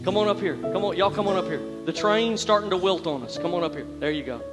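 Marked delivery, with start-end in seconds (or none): none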